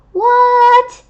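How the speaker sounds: a sudden high, loud "what?"; surprised. Real, not acted. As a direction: squeal it out in disbelief. Reacting to the silly picture.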